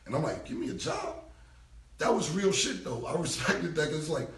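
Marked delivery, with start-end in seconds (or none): 0.5-1.2 high, offended echo of the offer; incredulous. Retold or imitated. To Dame offering him a job, incredulous high echo. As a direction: scoff it back in disbelief.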